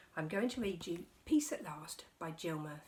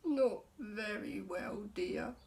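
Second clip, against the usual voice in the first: yawning; 3 semitones higher, much slower than usual. Acted. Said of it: "yawned Mister Bear".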